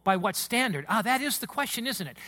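normal talking voice

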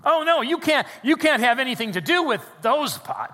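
high, loud mock-horrified protest; mock scandalized. Preacher mimicking fans who forbid liking Michigan, mock scandalized. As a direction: exaggerate the outrage at consorting with rivals. Mimics rival fans' objection.

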